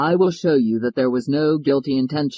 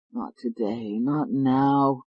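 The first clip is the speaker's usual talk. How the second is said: groaning; 4 dB quieter, much slower than usual. Acted. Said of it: "he groaned".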